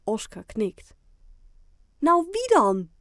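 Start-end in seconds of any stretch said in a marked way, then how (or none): 2.0-2.9 high, loud, pressing demand; impatient question. A narrator's reading. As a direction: push for the answer impatiently. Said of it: "vraagt Fien ongeduldig". (ongeduldig gevraagd)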